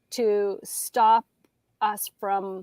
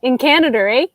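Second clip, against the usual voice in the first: louder, playful Canadian "in Canada, eh?"; mock Canadian accent; 3 semitones higher, 9 dB louder, faster than usual. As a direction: lean into a hammed-up Canadian "eh?". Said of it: "You really don't have an accent either".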